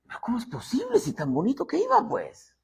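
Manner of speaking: high, quick, softly incredulous; puzzled, voice up high then dropping; baffled disbelief. Acted. Onlooker wondering at a fallen believer, baffled disbelief.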